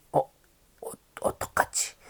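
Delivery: hushed frightened whisper. Acted. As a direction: whisper it so Taf won't hear. Mei whispers in hiding.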